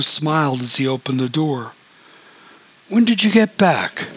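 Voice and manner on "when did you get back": high, bright surprised question; surprised greeting. A narrator's reading. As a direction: sound pleasantly startled at the door. Stepfather greets at door.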